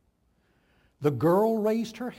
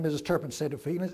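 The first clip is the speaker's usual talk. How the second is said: usual speaking voice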